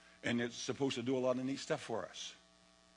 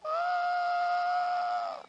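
very high joyful cry; ecstatic shout; 5 dB louder than usual. Retold or imitated. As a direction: let out a wild hallelujah shout. Mock joyful outcry.

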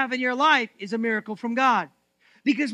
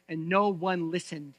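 usual speaking voice

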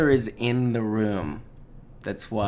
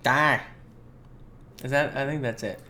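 put-on Boston accent; 3 semitones higher, 4 dB louder than usual. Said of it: "It's that accent".

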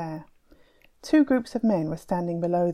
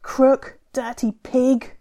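loud, higher yell, slowing on the last words; angry yell; 6 semitones higher, 6 dB louder, much slower than usual. Acted. Tufan yelling insults from behind his men, angry yell. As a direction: spit the insults across the square. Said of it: "Tufan yelled".

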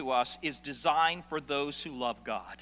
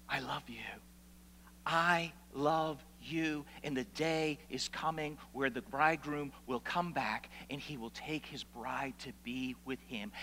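tender voice of God; 4 semitones lower, 8 dB quieter than usual. Acted. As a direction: murmur it gently, like a promise to a bride. God's tender words to his people.

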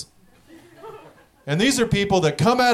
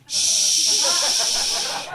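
drawn-out "shh"; long shushing hiss; 5 semitones higher than usual. Retold or imitated. Strange man hushing a couple at a show, long shush. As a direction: hush them with a long, steady shh.

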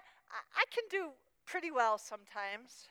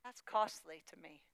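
usual speaking voice

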